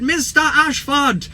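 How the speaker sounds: high, drawn-out Welsh lilt; Welsh accent. Retold or imitated. A Welsh fan calling to the vlogger outside a match, Welsh accent. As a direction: call it out slowly in a mock Welsh accent. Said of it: "in his Welsh accent".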